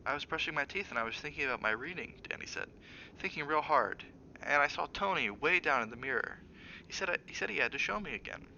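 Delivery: a lightly voiced young boy, puzzled; small boy Danny. Boy's voice again.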